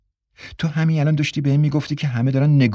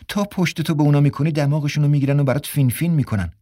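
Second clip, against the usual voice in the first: ordinary delivery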